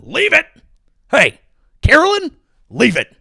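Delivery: high, sharp pet commands; yelling at a dog. Acted. Comic scolding press secretary like a dog, yelling. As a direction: call the name like a misbehaving dog's. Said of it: "you're just yelling at a dog".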